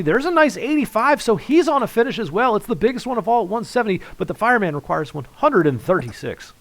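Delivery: high, loud play-by-play rush; excited commentary. Real, not acted. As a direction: call it with mounting excitement. Commentator calls a tense exchange.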